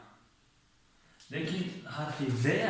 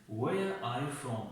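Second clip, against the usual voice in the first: very slow, every word spaced out; drawn out so learners hear each word; slow model question; much slower than usual. Shown as a demonstration. Teacher modelling the question for the class, very slowly. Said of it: "در این حالت ببینید".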